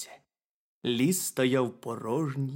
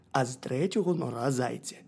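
ordinary delivery

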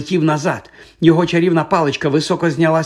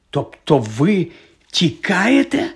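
halting question jumping higher at the end; pause, then ask incredulously; disbelieving; 5 semitones higher, much slower than usual. A narrator's reading. Boy realising the professor is fleeing, incredulous. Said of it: "усе ще не міг повірити Гаррі".

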